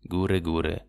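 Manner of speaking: low, fading dove coo. Acted. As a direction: let the coo die away. Waldtauben gurren zum Schluss. (leises, tiefes Gurren)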